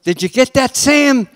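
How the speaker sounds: acted eager director's shout; calling to cameraman. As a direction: call across the set to the crew. Director calls to his cameraman.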